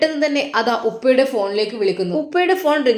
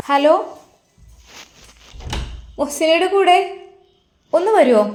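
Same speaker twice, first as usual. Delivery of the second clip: a nurse calling out to the family; 6 semitones higher than usual. Acted. (ഉയർന്ന ഉച്ചത്തിൽ നഴ്സിന്റെ വിളി)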